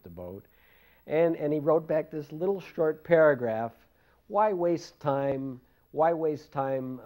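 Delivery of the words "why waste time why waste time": slow deliberate reading. Retold or imitated. Reading guru's letter slowly.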